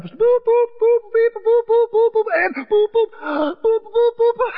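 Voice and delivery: very high, loud string of censor beeps; bleeped cussing. Retold or imitated. Player cusses at the charging pig, mock bleeping. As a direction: imitate a barrage of bleeped-out swearing. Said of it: "He's cussing up".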